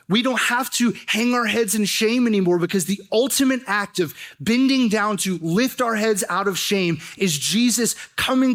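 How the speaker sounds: rising pulpit fervor; preaching at full force. Acted. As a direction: drive the point home to the congregation. Building sermon climax.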